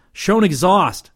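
high, loud, drawn-out call. A narrator's reading. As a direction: call the warning out to the crew. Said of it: "he called".

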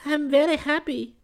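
very high feminine falsetto; happy sigh. A narrator's reading. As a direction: sigh it girlishly, high and contented. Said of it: "she sighed".

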